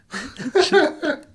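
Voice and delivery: a shrill burst of real laughter; high squealing laugh at the story. Real, not acted.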